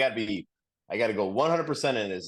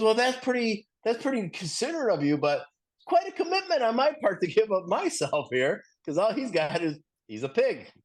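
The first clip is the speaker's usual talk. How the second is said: much higher, wry pig voice; 10 semitones higher than usual. Retold or imitated. Pig wryly turning down the chicken's plan, high and dry. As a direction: play the pig, pitched way up and dry.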